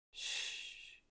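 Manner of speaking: soft unvoiced shh; a breathy soft shush. A narrator's reading. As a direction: hush her gently. Partner hushes the drunk girl.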